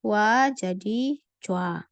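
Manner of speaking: cua drawn out slowly, c between c and j. Shown as a demonstration. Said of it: "Atau misal pakai". (cua diucapkan lambat)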